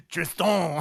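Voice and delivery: Tristan mimicked loudly, hammering the final TON; mock-French Tristan, big stress on the end; emphasized last syllable. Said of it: "you don't have to emphasize the, the last syllable".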